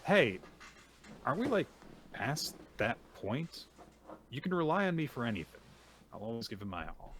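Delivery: voiced reassurance. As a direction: play a friend waving away thanks.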